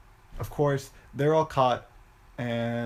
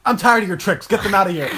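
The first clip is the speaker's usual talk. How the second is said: high, loud furious yell; angry shout; 6 semitones higher, 9 dB louder than usual. Retold or imitated. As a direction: blow up and order them gone. Villain loses temper, sends away.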